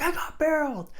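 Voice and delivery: high, loud boast of triumph; stoked. Retold or imitated. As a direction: imitate an overjoyed surfer shouting the news. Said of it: "he was so stoked".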